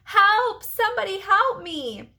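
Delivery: high, loud desperate cries; screaming for help. Acted. Marty begging the bus for help, screaming. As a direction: scream for rescue in a panic.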